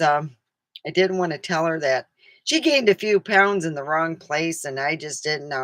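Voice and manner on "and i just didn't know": ordinary delivery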